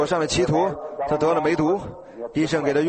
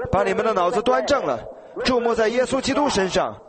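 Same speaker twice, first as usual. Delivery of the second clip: higher preacher's exhortation, driving each phrase; pitched-up pulpit appeal to believe; fervent preaching; 5 semitones higher than usual. Acted. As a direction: urge the crowd on like a revival preacher.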